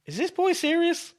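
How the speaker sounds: very high-pitched incredulous appeal; Trick's mock disbelief. Wrestler appealing to the crowd about his helper, mock incredulity. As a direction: act aghast, playing it to the crowd. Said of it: "look at the crowd and be like".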